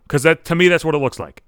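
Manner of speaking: normal talking voice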